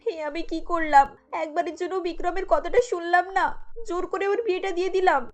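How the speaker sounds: tearful regret, fading as it goes; acted tearful. Monika regretting after reading the letter, acted weeping. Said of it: "কাঁদতে কাঁদতে বলে".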